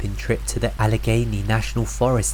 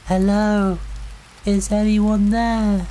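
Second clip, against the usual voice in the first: high, drawn-out call of a lost woman; distant woman calling; 10 semitones higher, slower than usual. A narrator's reading. Woman lost in the woods calling out to campers, high and slow. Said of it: "A voice, female, calling out".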